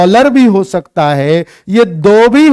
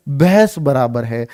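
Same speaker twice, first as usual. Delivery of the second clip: भैंस बराबर है said lower and softer as a punchline; stressed idiom ending; 6 semitones lower, 5 dB quieter than usual. Shown as a demonstration. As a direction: land भैंस बराबर है low to underline it. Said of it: "तो आप इसको underline करना चाह रहे हो". (मुहावरे पर ज़ोर देकर धीमे स्वर में)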